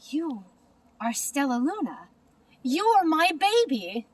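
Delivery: plain reading voice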